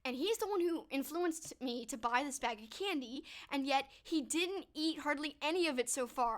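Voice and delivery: ordinary delivery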